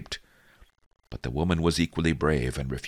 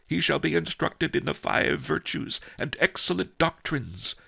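solemn abbot; 6 semitones higher than usual. Acted.

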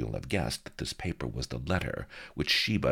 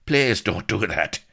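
higher, louder plea; pleading; 6 semitones higher, 7 dB louder than usual. A narrator's reading. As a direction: beg her to stop. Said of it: "he pleaded".

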